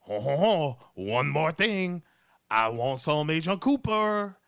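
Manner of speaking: gloating mock Jean Reno; Jean Reno impression. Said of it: "Jean Reno is like".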